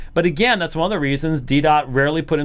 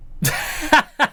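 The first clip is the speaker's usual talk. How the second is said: high burst of laughter; 12 semitones higher than usual. Real, not acted. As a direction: laugh out in a long run. Breaks off and laughs.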